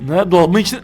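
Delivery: ordinary delivery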